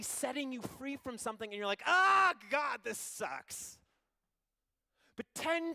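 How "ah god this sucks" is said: loud, high mock groan at God; whiny shout. Preacher mocking ungrateful Israel, loud whiny shout. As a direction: throw a spoiled tantrum.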